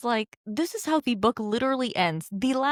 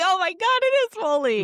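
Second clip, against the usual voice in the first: much higher, louder squeal of recognition; thrilled realization; 9 semitones higher, 5 dB louder than usual. Retold or imitated. Reader whose guess comes true, squealing with excitement. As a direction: shriek it like the guess came true. Twist confirmed while reading.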